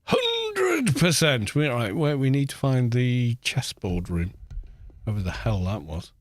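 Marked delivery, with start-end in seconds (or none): 0.1-1.5 tag exclaimed. Real, not acted. Answering own question in drawn-out exclamation.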